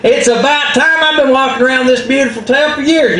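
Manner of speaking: high, laughing braggart voice; boastful Possum. Acted. Possum bragging to Coyote, high laughing swagger. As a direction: crow about it like a vain show-off. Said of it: "And Possum says".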